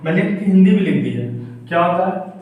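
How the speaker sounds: ordinary talk, nothing marked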